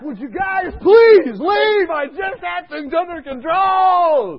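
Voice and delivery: high, loud, drawn-out plea; exasperated shout. Retold or imitated. Nurse asking guards to leave, exasperated shout. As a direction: shout it over a yelling patient.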